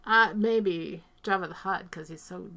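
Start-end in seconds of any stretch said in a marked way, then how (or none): 1.5-2.5 hushed whisper, like an aside. Real, not acted. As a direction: breathe it out as a secret. Confessing a disliked character.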